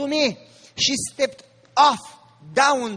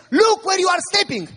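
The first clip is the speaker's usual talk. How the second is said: high-pitched retold shout of warning; urgent warning cry; 7 semitones higher, 3 dB louder than usual. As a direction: call out as if danger is underfoot. Frightened friend warns on rotten bridge.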